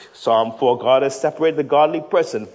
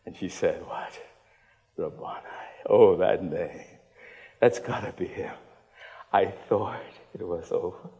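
tender closing appeal; 5 semitones lower, 6 dB quieter than usual. Acted. Preacher softly telling believers they matter, tender. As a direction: draw them in gently.

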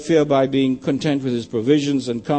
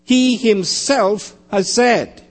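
slow, higher, weighty "He himself"; stress on HE HIMSELF; 4 semitones higher, much slower than usual. Shown as a demonstration. Preacher repeating the key phrase of the passage, emphatic. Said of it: "The emphasis on who is making the statement".

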